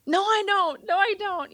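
high-pitched, emphatic mock refusal, resisting hard; loud refusal. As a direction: insist "No, I don't" high and a bit too loud, half laughing. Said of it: "sorry, I'm probably talking too loud".

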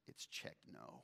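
breathy whisper of defeat; whispered heartbreak. Acted. Young boy reads Lacey's reply, crushed, in a whisper. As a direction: let it drop to a crushed whisper.

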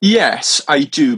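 ordinary talk

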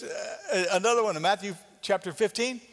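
normal talking voice

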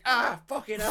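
high-pitched comic yelp of pain; mock pained cry. Retelling man hit by a hammer, mock pained outburst. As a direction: cry out as if just whacked.

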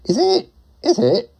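high-pitched questioning repeat; squeaky doubt. Pretend. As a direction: pitch leaps up on each question. Doubting the sandwich price.

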